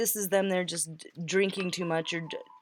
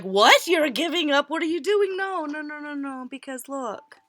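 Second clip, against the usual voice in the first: higher, alarmed what are you doing; higher, pleading no no no; startled disbelief; 7 semitones higher, 3 dB louder than usual. Retold or imitated. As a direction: panic as they realise you're leaving. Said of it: "when they're like".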